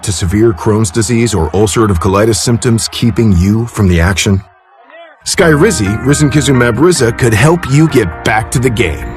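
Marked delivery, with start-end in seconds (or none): none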